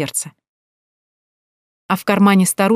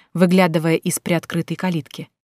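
ordinary delivery

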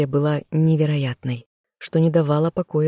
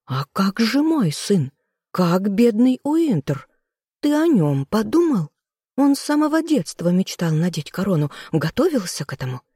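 upset sister; 5 semitones higher than usual. A narrator's reading. Sister pleading for her son's crown, high and upset. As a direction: beg for her son.

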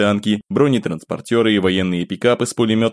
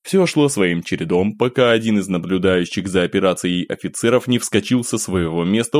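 plain reading voice

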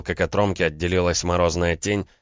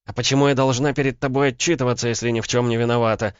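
high-pitched indignant female character; indignant retort; 7 semitones higher, 4 dB louder than usual. A narrator's reading. Woman defending herself to a suspicious man, indignant. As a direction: push back at the accusation, pitched up. Woman snaps back at accusation.

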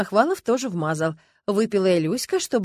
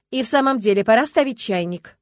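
loudly; 3 semitones higher, 8 dB louder than usual. A narrator's reading. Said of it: "громко сказала Люба".

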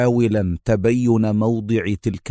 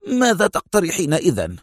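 mildly sharp, raised-pitch challenge; sharp reply; 8 semitones higher than usual. A narrator's reading. Consul challenging Mona for a suggestion, with a touch of sharpness. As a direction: throw the question back sharply. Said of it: "أجابها في حدة".

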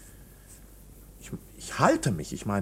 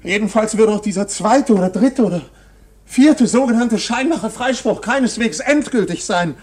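much higher and louder, rising alarm; agitated outcry; 7 semitones higher, 10 dB louder than usual. Acted. Accused man to the painter, an agitated loud outcry. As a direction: push it out in growing alarm. Accused realises acquittal never final.

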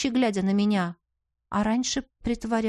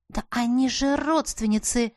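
ordinary delivery, nothing marked

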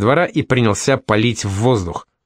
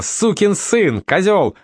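high angry shout of insults; furious screaming; 9 semitones higher, slower than usual. A narrator's reading. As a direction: hurl the insults at him. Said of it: "Ember screaming insults".

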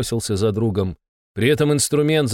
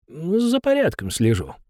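slow, lightly voiced old man; old caretaker voice; 7 semitones higher than usual.

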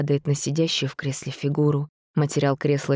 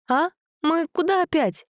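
put-on girl voice; 14 semitones higher than usual. Dasha voiced, surprised.

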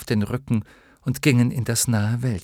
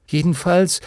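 higher, lifted, making up; conciliatory; 9 semitones higher, 5 dB louder than usual. A narrator's reading. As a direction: offer it brightly to make peace. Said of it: "sagte Bastian versöhnlich". (versöhnlich, mit hoher Stimme)

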